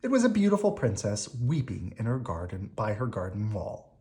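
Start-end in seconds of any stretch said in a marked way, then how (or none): none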